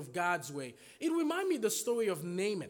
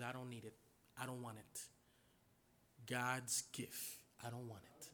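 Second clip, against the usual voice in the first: much softer and lower, humble refusal; quiet refusal; 10 semitones lower, 15 dB quieter than usual. Acted. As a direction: turn the gift away gently, almost under breath. Prophet humbly refuses the gift.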